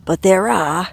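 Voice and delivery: hammed-up Australian accent, Crocodile-hunter style; a playful, terrible Australian accent; mock Australian nature-show voice; bad Australian accent. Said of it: "forgive my terrible Australian accent".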